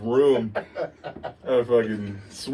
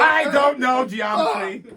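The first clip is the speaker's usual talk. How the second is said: high, slow mock-dumb yell; dumb shout; 13 semitones higher, slower than usual. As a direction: bellow it like a proud idiot.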